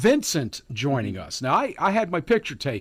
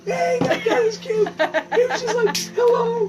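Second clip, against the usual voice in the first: very high, laughing squeal; high-pitched delighted cooing; gushing squeal; 4 dB louder than usual. Real, not acted. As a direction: gush over something adorable, laughing. Gushing over a cute animal.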